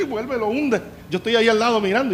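ordinary talk

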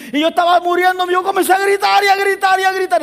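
high, loud, full-force climax; peak preaching. Acted. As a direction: preach it at the top of the voice.

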